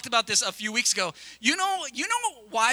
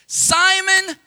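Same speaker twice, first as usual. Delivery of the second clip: called out louder and higher; calling out; 8 semitones higher, 8 dB louder than usual. Shown as a demonstration. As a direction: ring out the name like a summons. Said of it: "Say that with me".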